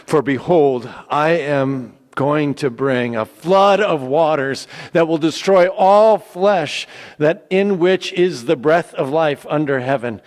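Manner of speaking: God warning Noah of the flood, booming; booming proclamation. Acted. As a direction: proclaim it like a decree from heaven. God's command read aloud.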